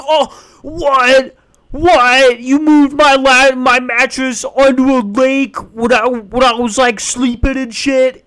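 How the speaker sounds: high, loud mock-dumb voice; dumb TikTok victim voice. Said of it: "the person's like".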